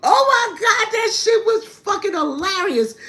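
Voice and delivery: high squeal through laughter; laughing hard. Real, not acted. Telling viewers about the pea patch, laughing high. Recalling grandmother whooping her aunt.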